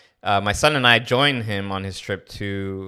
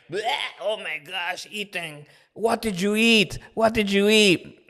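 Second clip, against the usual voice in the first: disgusted outcry; 9 semitones higher than usual. Retold or imitated. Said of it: "And I'm like".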